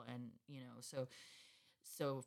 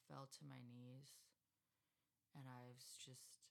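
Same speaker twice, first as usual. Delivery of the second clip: very soft, subdued, with long pauses; real grief; 15 dB quieter than usual. Real, not acted. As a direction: recount a devastating loss quietly, haltingly. Recalling her family's deaths, in grief.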